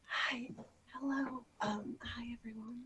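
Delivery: barely audible, shy hello; very quiet. Pretend. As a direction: murmur a timid hello. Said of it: "we're switching personalities".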